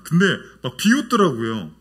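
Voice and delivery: normal talking voice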